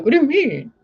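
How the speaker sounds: high, loud baffled question; confused. Retold or imitated. Tenants puzzled about their paid rent, high and loud. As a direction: sound utterly puzzled. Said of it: "They'd be like".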